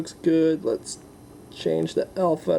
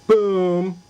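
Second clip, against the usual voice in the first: loud triumphant boom; excited boom; 5 semitones higher, 6 dB louder than usual. Real, not acted. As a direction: punch it out in triumph. Hitting the gravity target.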